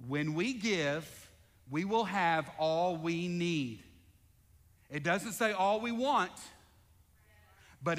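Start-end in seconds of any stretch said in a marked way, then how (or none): 0.1-3.8 slow, deliberate congregational reading; slow reading together. Shown as a demonstration. Pastor leading the church in reading, slow and deliberate. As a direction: read it aloud slowly with the church. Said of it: "let's read that together".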